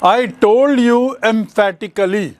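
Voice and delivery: slow, with TOLD stressed hard; said emphatically. Shown as a demonstration. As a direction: lean on "told" to show emphasis. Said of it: "emphatic मतलब क्या होता है?".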